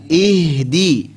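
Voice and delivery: "Ihdi" with a long airy H; flowing H. Shown as a demonstration. Teacher modelling rokhawah, "Ihdi" with flowing H. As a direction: let breath flow through the H of "Ihdi".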